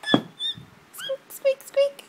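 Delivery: very high squeaky squeak squeak squeak; mouse squeak. Shown as a demonstration. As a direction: squeak like a tiny mouse for the baby. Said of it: "What's a mouse say?".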